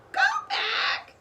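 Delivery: parrot squawk. A narrator's reading. Polly warns the children, high squawk. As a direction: squawk it shrilly. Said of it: "squawked Polly".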